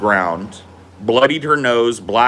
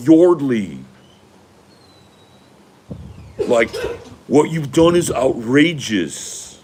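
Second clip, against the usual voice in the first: put-on higher, drawn-out entitled rich boy plea; smug rich boy voice, pitched up; imitating George Hughley; 4 semitones higher, slower than usual. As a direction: play the privileged college boy coaxing her calmly. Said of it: "I'm doing a very good impression of George Hughley".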